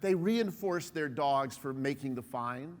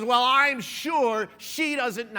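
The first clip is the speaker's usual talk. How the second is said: higher, louder, slow indignant snap; retold angry retort; 6 semitones higher, 9 dB louder, much slower than usual. Angry woman snaps back at the speaker, slow indignant retort. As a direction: drag it out, sharp and offended. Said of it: "she was really angry".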